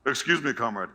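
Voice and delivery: politely addressing a visitor, low-key; a hint of a courteous guard; polite security guard. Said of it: "Extremely polite".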